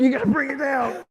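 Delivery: loud, high strained shout. Real, not acted. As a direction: yell it while stretching for the ball. Can't reach, calls to partner.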